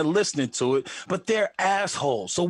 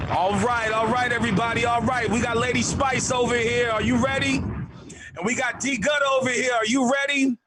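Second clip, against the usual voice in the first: radio DJ hype; 7 semitones higher than usual. Retold or imitated. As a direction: hype the crowd like a club DJ. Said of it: "He's like".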